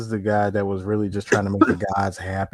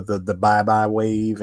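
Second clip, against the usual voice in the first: ordinary talk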